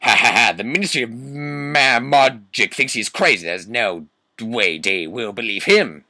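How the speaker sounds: put-on villain voice with loud mock laugh; hammed-up sneering teacher, pushed and theatrical; Snape, mean laugh. Teacher scoffing at the ministry, full mean character voice. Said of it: "let me get my Snoop voice up".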